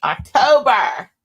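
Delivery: loud excited scream; screaming at her friend. Retold or imitated. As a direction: shout it out in delight. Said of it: "Then I start screaming".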